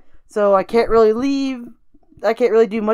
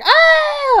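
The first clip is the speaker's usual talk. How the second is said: high piercing scream of pain, pitched way up; retold scream; 5 dB louder than usual. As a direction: let out a sharp, very high yelp of pain. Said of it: "I'm screaming, right?".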